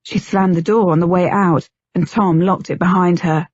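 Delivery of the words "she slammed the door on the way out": ordinary delivery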